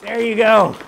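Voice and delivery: excited. Real, not acted. Just split the apple.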